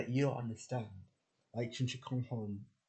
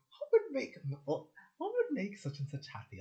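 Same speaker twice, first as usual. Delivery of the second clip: high eager wondering; keen child's musing voice; eager inner voice; 11 semitones higher, 4 dB louder than usual. Retold or imitated. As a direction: ask it brightly, up high. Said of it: "I just think".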